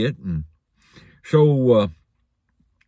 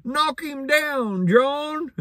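high, slow mock holler up a tree; Jerry hollering up at his partner in the tree; hollering from the ground; 14 semitones higher, much slower than usual. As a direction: call it up the tree, drawn out. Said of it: "kept a hollering".

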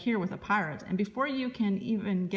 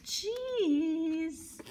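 higher, drawn-out pirate cheese; pirate says cheese; 9 semitones higher than usual. Acted. Pirate posing for the elf's photo, high silly cheese.

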